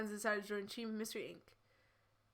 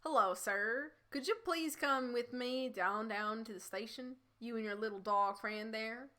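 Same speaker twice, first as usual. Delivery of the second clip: put-on Texas accent. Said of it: "Texas accent".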